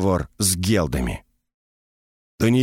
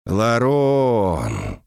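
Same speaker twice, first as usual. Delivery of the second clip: long, teasing call of a name; mocking drawl. A narrator's reading. Ancient mage greets a former apprentice he knows, mocking drawl. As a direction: draw the name out slowly, mockingly. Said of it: "протянул он насмешливо".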